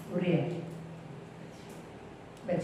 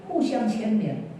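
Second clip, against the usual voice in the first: usual speaking voice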